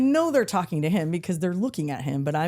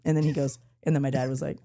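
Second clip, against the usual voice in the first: normal talking voice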